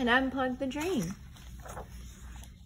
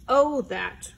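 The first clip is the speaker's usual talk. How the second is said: louder dismissive oh that; crying out; 6 dB louder than usual. Acted. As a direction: brush it off loudly. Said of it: "cried Bear".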